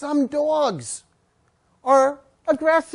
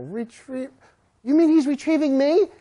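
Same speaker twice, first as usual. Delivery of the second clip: ordinary talk, nothing marked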